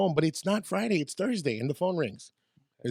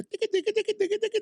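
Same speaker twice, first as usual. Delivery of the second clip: rapid high ticka-ticka vocal noise; imitated accordion riff; faster than usual. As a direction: mimic a fast accordion with your mouth. Imitates accordion music from radio.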